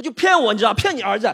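loud, high outrage; indignant. Acted. Complaining to mom he was cheated, loud and high. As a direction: hammer the injustice.

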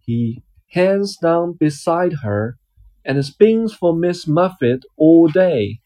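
lively picture-book reading voice; reading to a child. Acted. Dad reading a picture book to his child, storytelling voice. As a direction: read it out like a bedtime story for a little one.

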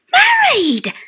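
high, loud burst of delight; narrator's delighted cry at his friend's news; joyful cry. A narrator's reading. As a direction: shout it out with sheer joy. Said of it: "I cried joyfully".

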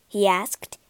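ordinary delivery, nothing marked

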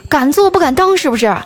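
hammed-up indignant calling up from downstairs; mock angry shout. Car owner yells up at whoever dropped the flowerpot, mock angry shout. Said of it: "楼下开豪车那男的大声喊道".

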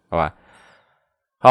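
ordinary talk

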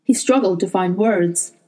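plain reading voice

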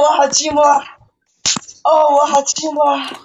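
put-on moaning calls. Forfeit player pleading with viewers by nickname, hammed-up moaning. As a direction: wail each name with hammed-up longing.

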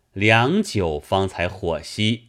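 plain reading voice

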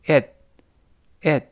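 "it" with the final t held, not released; "it" cut off before the t bursts; unreleased T. Shown as a demonstration. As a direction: stop the t of "it" on the tongue. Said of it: "读快之后".

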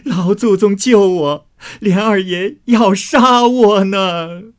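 high, loud, drawn-out cry for help; wailing plea. Acted. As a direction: wail for rescue, stretching the last phrase slowly. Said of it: "便哭着往贾母那边跑". (哭喊求救，拖长声音)

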